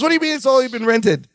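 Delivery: high, quick put-on TV host voice; Robin Leach. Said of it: "Robin Leach voice".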